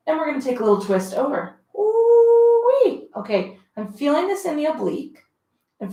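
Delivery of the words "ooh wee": much higher, louder ooh-wee of strain; exclaimed high ooh-wee; ooh-wee of effort. Real, not acted. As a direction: cry out at the burn. Feels the twist in obliques.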